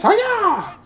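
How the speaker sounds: high, drawn-out hiya; a playful martial-arts yelp; mock karate cry. Shows how they set up fences.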